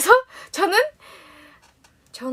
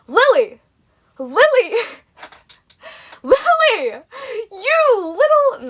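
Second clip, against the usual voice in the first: loud, high, drawn-out telling-off; mock scolding; 10 semitones higher, 7 dB louder, much slower than usual. Mum on the phone to her daughter, exaggerated scolding. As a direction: call the name out in hammed-up outrage. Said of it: "she didn't actually say it like that".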